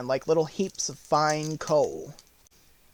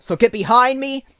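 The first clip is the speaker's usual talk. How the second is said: higher, loud, protective woodman; bold Tin Woodman; 5 semitones higher, 7 dB louder than usual. Acted. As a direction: call it out bravely to friends. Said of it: "said the Woodman".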